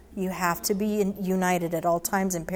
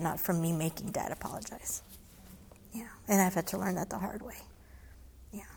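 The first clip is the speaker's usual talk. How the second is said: whisper; 8 dB quieter than usual. Real, not acted. Confiding aside about herself.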